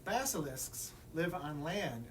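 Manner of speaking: ordinary delivery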